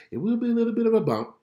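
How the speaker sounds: reassuring. Acted. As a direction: lift it to reassure. Prophetic reassurance.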